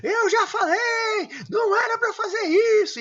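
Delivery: very high, loud yell with a crying edge, slowed right down; furious scolding shout. Acted. Parent losing it at a child, furious shout. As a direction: yell at the child as if at the end of your rope. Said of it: "Qual que é a pior bronca que você dá?".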